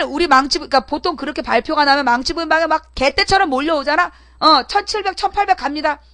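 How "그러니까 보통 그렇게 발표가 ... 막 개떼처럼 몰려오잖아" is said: usual speaking voice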